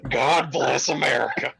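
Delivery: hammed-up all-American declaration, high and loud; mock patriotic American. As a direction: proclaim it like an over-the-top patriot. Hammed-up boast after "I'm so American.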